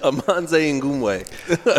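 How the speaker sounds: repeated on request. Real, not acted. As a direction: repeat "Amonze Ngumwe" slowly, with a lifted pitch. Asked to say the name again.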